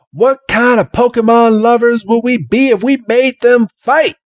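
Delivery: high, indignant rising question; shocked disbelief, voice climbing; high outraged voice. Retold or imitated. Man outraged.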